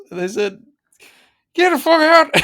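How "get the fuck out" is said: much higher, louder mock rebuff; mock dismissive yell. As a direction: shout them out the door, hammed up. Retold venue staff refusing.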